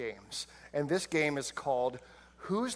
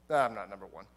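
low denial; 9 semitones lower, 4 dB quieter than usual. Retold or imitated. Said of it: "Judas is like".

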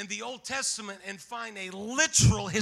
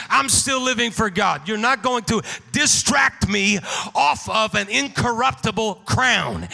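louder, driving preacher cadence; fervent preaching; 6 dB louder than usual. Acted. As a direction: preach it out to the congregation. Preacher rising into shouted exhortation.

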